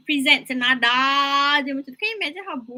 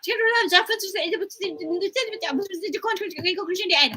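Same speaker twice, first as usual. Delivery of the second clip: breathless run-on; 6 semitones higher, faster than usual. Shown as a demonstration. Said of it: "Jangan cakap".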